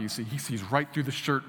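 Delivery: ordinary talk, nothing marked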